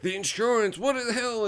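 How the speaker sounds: high-pitched mock outrage; panicked boss. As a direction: voice rising in hammed-up alarm.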